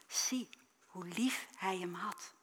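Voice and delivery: breathy whisper, slow; hushed whisper. Acted. Mourners at the grave whisper, hushed.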